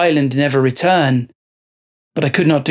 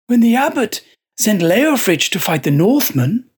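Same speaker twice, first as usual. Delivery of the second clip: a possessed monk, higher and measured; fervent monk; 7 semitones higher than usual. Acted. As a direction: justify it with calm conviction. Joseph justifies his deed.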